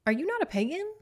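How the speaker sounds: high, loud disbelief; sharply rising "Are you not a pagan?"; mock-shocked squeal; incredulous. Real, not acted. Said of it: "mock surprise at pagan".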